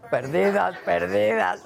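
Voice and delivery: repeated like a fading echo; echo effect. Pretend. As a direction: say it twice like an echo. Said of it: "el efecto perdidas".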